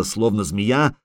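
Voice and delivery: ordinary delivery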